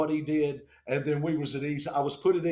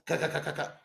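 staccato helicopter blade noise; imitated rotor chop; faster than usual.

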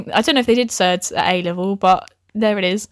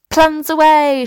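higher, louder character shout; shouted rallying cry; 6 semitones higher, 5 dB louder, slower than usual. A narrator's reading. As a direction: call it out to rally the gang. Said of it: "shouts Maisie".

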